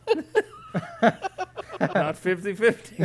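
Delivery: high giggling through words; laughing. Real, not acted. Laughing at wrong guess.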